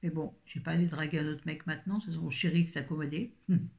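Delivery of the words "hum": ordinary talk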